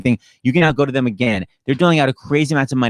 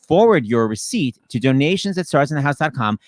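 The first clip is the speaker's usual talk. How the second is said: ordinary talk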